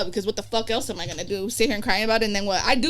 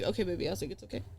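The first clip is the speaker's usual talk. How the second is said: soothing daughter; 4 semitones lower, 12 dB quieter, faster than usual. Retold or imitated. Comforting her daughter, retold.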